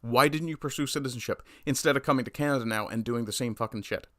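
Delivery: ordinary talk, nothing marked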